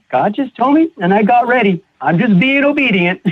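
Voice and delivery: high-voiced mock-innocent mother; imitated mother. Mother's reply, voiced high, ends laughing.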